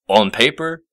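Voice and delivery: ordinary delivery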